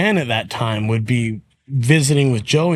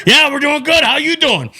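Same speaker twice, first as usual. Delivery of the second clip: much higher, louder cheerful greeting; Joey's pumped-up voice; 12 semitones higher, 4 dB louder than usual. Retold or imitated. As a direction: greet the staff while still fired up. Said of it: "pump himself up".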